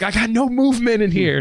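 half-hearted yell of a man stuck in ripped pants; retold yelling. Stuck in torn pants at the game, mild mock yell. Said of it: "And I'm, like, yelling".

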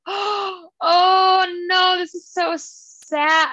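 reliving a sad scene with a cry; mock sad wail. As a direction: wail it like the news breaks your heart. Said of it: "I was just, like, so sad".

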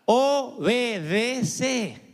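OBEDECE stretched syllable by syllable, very slow; drawn-out emphasis. Real, not acted. Preacher giving the congregation the one key word, emphatic "obedece" drawn out. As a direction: spell out "obedece" in slow, separate beats.

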